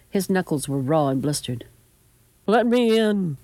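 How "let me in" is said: high, pleading grandson; raised, distressed voice; desperate plea. A narrator's reading. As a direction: beg to be let inside. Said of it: "He had been crying".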